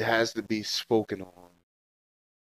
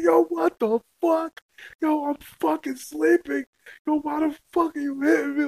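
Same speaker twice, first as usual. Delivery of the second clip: high, loud, outraged shouting; retold furious yelling; 4 dB louder than usual. Man shouting at his baby mama for waking him with a hit, outraged and loud. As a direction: yell it like you can't believe she hit you. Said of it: "Yo, I was like".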